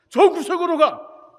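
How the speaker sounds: high, slow shouted order; robber's command. Retold or imitated. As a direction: yell it like a threatening robber. Robber orders them to corner.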